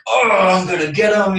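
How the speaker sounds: high, mock vengeful outburst; resurfacing anger. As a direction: act out a flare of vengeful anger. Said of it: "the feelings I've just let go of now resurface".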